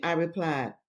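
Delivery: usual speaking voice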